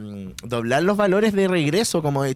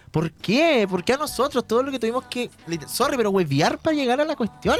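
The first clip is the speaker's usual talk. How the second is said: high-pitched, aggrieved "why us" lament; retold frustration; 8 semitones higher than usual. Telling why missing the show hurt, frustrated lament. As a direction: complain as if fate has been unfair. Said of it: "lo que más me frustraba".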